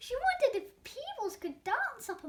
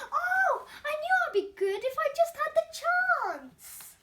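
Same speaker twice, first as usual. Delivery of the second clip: much higher and louder, bursting with joy; joyful shout; 9 semitones higher, 10 dB louder than usual. A narrator's reading. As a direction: shout it out like you've finally won. Glump's joyful dance, cheering.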